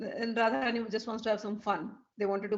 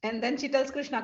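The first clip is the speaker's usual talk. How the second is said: ordinary delivery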